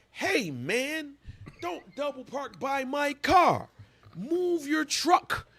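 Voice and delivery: high, slow mock demand; Mark Sanchez yelling. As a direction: act out a pushy athlete telling him to move. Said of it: "And Mark Sanchez was like".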